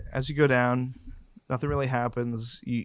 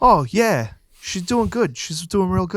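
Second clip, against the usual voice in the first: high, loud Boston drow voice; a hammed-up Boston guard; thick Boston accent, pitched up; 7 semitones higher, 7 dB louder than usual. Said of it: "put on your best Boston accent".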